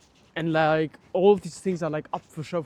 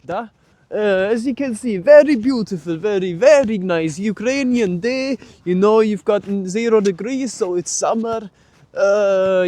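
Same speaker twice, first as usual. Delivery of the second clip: put-on over-the-top Russian accent, pitched up; mock Soviet accent; 2 semitones higher, 3 dB louder than usual. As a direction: play a jokey Soviet patriot with a thick Russian accent. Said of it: "my special Ukrainian, Russian or Soviet accent".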